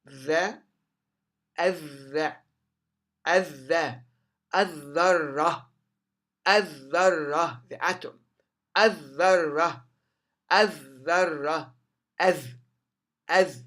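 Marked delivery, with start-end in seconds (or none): 1.6-7.6 "الذرة" with the lam dropped and a doubled dh; silent lam. Shown as a demonstration. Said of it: "تختفي اللام". 8.8-13.6 "الذرة" as adh-dharra, no l heard; silent lam. Shown as a demonstration. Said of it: "The lam disappears".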